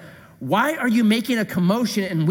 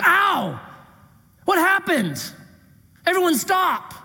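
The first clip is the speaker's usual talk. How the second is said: high yelp of surprise, then a startled demand; jolted, higher and calling a halt; mock startled cry; 11 semitones higher than usual. Jesus jolted when the woman touches his garment, high and startled. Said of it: "being like".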